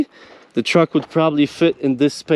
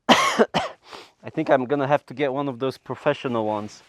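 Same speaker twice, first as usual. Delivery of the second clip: muffled through a dust mask; talking through a mask; 4 semitones lower, 7 dB quieter than usual. Real, not acted. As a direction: speak as if behind a breathing mask. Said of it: "I can't breathe in this mask".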